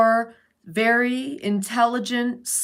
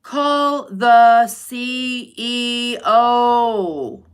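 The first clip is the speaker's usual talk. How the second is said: every word slow and louder, stretched out; drawn-out emphasis; 6 dB louder, much slower than usual. Real, not acted. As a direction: lay it down slowly like a command. Emphatic advice to listener.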